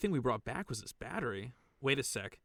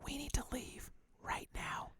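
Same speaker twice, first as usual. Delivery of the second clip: whisper; 12 semitones higher, 9 dB quieter than usual. Acted. Sneaking away from rogue AI.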